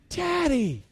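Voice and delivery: put-on high, gleeful call of a little girl; excited child's cry. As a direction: call out like a thrilled toddler. Daughter runs to greet father.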